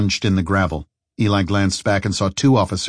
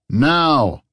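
loud megaphone shout; shout over PA; 6 semitones higher, 3 dB louder than usual. Acted. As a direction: yell it through a loudspeaker. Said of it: "shouted the officer through the P A system".

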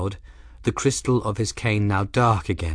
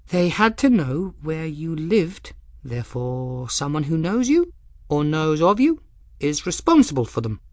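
lightly voiced girl, higher, reasoning it through; a keen pupil working out the answer aloud; young apprentice Folly; 7 semitones higher than usual. A narrator's reading. Folly answering her teacher, a lightly higher girl's voice.

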